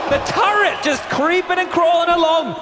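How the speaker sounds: high, loud drawn-out play-by-play call; excited esports caster at a peak; excited commentary. Real, not acted.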